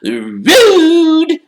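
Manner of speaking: shrieking, gleeful poltergeist; Peeves. A narrator's reading. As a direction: scold loudly in a very high voice. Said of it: "said Peeves, his eyes dancing".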